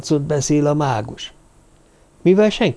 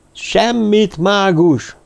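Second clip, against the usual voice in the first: crowd roaring; 6 semitones higher, much slower than usual. A narrator's reading. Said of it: "zúgta a tömeg".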